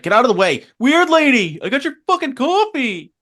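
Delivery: put-on rude teen Billy. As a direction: shove past her as a snotty kid, loud and high. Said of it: "And Billy's just like".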